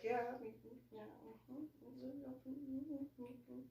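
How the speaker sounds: soft, barely voiced polite mm-hmms; awkward mumbling. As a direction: nod along uncomfortably under your breath. Awkwardly sitting among the males.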